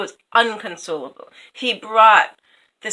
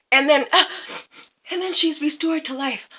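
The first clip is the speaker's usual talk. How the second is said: ordinary delivery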